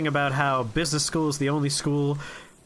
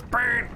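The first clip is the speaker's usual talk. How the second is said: sharp imitated intercom buzz, pitched up; buzzer noise; 8 semitones higher than usual. Imitating the crappy desk buzzer before the boss yells. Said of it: "crappy buzzer at his table goes".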